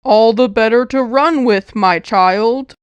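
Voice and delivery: put-on a wolf coaxing the girl into bed; luring wolf. A narrator's reading. Wolf voiced in story.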